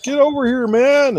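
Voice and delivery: high call to a wandering buddy. Retold or imitated. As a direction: shout him back over here.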